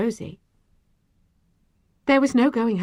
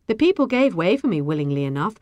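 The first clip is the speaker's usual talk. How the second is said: plain reading voice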